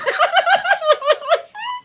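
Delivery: squealing high-pitched laugh; a real shrieking laugh. Real, not acted. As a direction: burst into a high giggle. Laughing at the lady.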